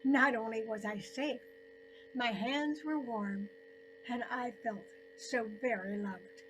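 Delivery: plain reading voice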